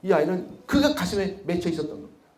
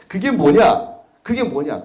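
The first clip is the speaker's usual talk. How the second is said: loud rhetorical question; 3 semitones higher, 8 dB louder, slower than usual. Real, not acted. Speaker challenging the audience, loud and raised. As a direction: punch the question out loud and high.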